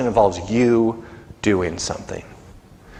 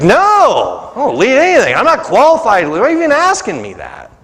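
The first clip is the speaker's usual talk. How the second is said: higher, louder startled no; incredulous refusal; 6 semitones higher, 3 dB louder than usual. Retold or imitated. Pastor retelling refusing to lead the group, loud incredulous protest. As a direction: recoil from the offer, disbelieving. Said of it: "It's like".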